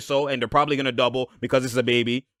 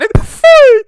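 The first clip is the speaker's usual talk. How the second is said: a high, loud burst of laughter; laughing out loud at the film; 14 dB louder than usual. Real, not acted. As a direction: crack up at the replay.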